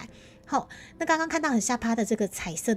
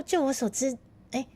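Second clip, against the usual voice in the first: normal talking voice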